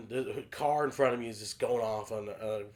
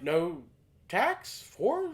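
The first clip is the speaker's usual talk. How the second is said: rising higher in disbelief, No tax, Four; a customer shocked by the tiny price, asking in high disbelief; surprised disbelief; 4 semitones higher, much slower than usual. Retold or imitated.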